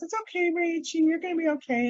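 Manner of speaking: lightly voiced, tender reassurance; reassuring friend. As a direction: soothe her gently like an old friend. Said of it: "she says".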